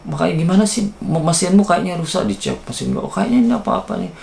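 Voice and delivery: imitating scolding boss. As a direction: replay the boss lecturing you, pitched high. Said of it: "Dia ngomongnya".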